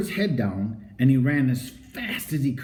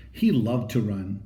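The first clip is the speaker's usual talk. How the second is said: plain reading voice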